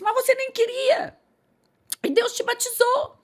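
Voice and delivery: high-pitched imitation of envious churchgoers; resentful complaint. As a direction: mimic a wounded, indignant lament. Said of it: "começassem até a ficar ressentidas". (imitando queixa ressentida, em voz bem aguda)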